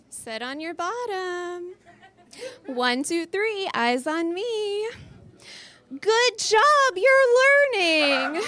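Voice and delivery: high, slow, sing-song classroom voice; kindergarten teacher voice. Acted. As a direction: build to a shrill cheery praise. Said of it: "if I sounded like that in bed".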